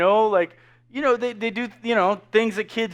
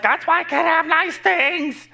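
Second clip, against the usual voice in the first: imitating Mom; 7 semitones higher than usual. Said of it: "And Mom's like".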